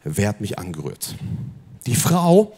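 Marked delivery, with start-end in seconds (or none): 0.0-1.1 low voice as Jesus. Acted.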